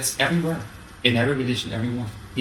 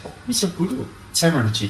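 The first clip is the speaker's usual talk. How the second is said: mock fawning; 6 semitones higher than usual. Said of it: "they say".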